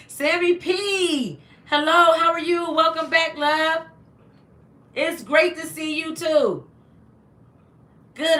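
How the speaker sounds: high, loud, bubbly hello to a viewer; excited greeting. Real, not acted. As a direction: greet a friend you're thrilled to see.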